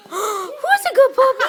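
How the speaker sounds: high squeaky puppy talk; talking to a dog. Real, not acted.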